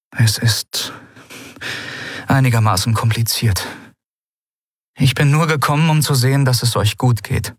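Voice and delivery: a daughter hiding the truth; Lien, evasive daughter. Acted. Lien's voice, higher than narration. (Lien, ausweichend, höhere Stimme)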